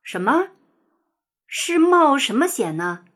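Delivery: puzzled rooster, voice lifted; baffled. A narrator's reading. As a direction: ask in bewilderment. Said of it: "老公鸡莫名其妙".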